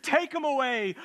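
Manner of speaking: high, loud repeated yell; crowd crying out. Acted. Preacher voices the mob demanding Jesus be taken, yelling. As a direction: howl it as the angry mob. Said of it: "they cried out".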